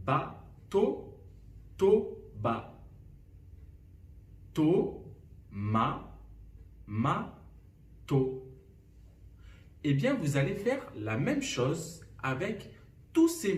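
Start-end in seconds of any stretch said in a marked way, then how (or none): 0.1-8.5 Ba, to, to, ba, To, ma, ma, to, each a separate beat; isolated syllables. Shown as a demonstration. Teacher recaps two inversion examples, syllables spaced apart. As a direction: pause after every single syllable of "Ba, to, to, ba". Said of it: "je vous ai donné deux exemples".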